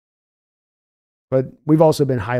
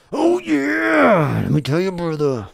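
high gravelly wrestler growl; Randy Savage voice; 5 semitones higher, slower than usual. Acted. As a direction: hype it up like the Macho Man. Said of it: "I do a middling, uh, Randy Savage".

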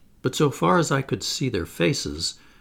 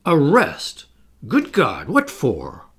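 put-on louder, startled old man crying out; old man thunderstruck at the word arrest; shocked outburst; 3 semitones higher, 5 dB louder, slower than usual. A narrator's reading. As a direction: blurt it out in shock. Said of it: "he looked really shocked".

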